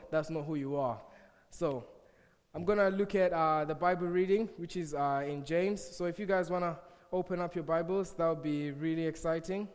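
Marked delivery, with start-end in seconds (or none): none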